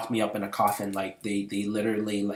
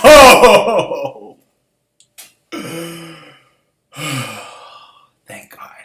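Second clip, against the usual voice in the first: relieved gasp; 8 semitones higher, 18 dB louder, much slower than usual. Retold or imitated. Waking relieved from nightmare.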